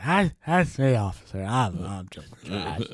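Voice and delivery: put-on slurring drunk, slowing down at the end; sloppy tipsy confession to the officer; drunk impression. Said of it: "do your impression of you being drunk".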